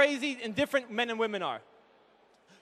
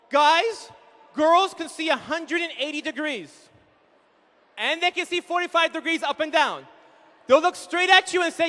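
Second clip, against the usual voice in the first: usual speaking voice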